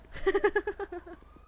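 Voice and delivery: high burst of laughter after yelling; amused laugh. Real, not acted. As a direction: crack up at your own outburst. Laughs after own mock yell.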